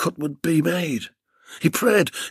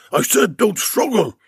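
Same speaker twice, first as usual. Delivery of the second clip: shouting; 6 semitones higher than usual. Acted. Captor loses patience with struggling Bill, shouting. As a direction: bark it as a furious command. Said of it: "the voice shouted".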